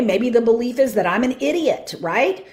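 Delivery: usual speaking voice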